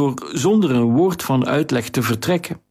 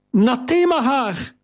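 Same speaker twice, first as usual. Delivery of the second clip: "Nathema haar!" called out much higher, "Nathema" stressed; joyful cry; 10 semitones higher than usual. Acted. Kariline throwing herself at the stranger, crying out joyfully. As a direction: fling out the name in glad recognition.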